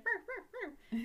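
high-pitched mock dog barks; comic high yapping; imitated barking. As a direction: bark like a little dog. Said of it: "so he starts barking".

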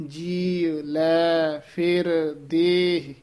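ਜੀ, ਲੈ, ਫਿਰ, ਦੇਹ each slow and separate; separate words, like counting. Shown as a demonstration. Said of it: "ਗਿਣਤੀ ਨਾਲ ਵਾਚਕ ਸ਼ਬਦ".

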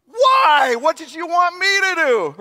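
loud, high, indignant protest; exasperated shout. Retold or imitated. Disciple in the storm shouting back at Jesus, indignant. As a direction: yell back at Jesus in mock outrage. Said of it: "I'd be going".

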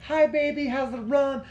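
put-on very high-pitched girlfriend voice; imitated girlfriend. Girlfriend greeting runner from the pool, imitated. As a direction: mimic her calling a greeting from the pool. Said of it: "She's in the pool looking over. She's like".

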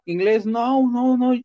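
high, whimpering no, no, imitating someone about to cry; mock tearful pleading. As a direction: mimic a scared guy begging not to be hit. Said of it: "y ya queriendo llorar".

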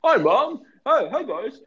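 high, goofy addled greeting; hammed-up brain-addled cartoon voice; Odell Beckham. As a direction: say hello in a silly swooping voice. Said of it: "and Odell goes".

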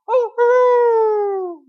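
loud, high hooted crow; rooster crow imitation. Preacher imitating the rooster after Peter's third denial, crowing. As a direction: crow like a rooster, held long.

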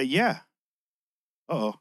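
ordinary delivery

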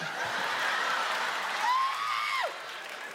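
squealing high-pitched mock giggle. Giddy wife giggling at her man coming home, very high.

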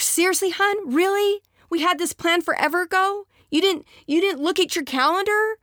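high, incredulous wife; disappointed wife. Acted. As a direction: cannot believe he cancelled.

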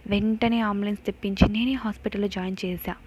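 ordinary delivery

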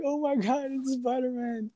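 high, soft mock-kid voice; hushed high squeal of a starstruck kid; kid freaking out. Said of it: "he starts freaking out".

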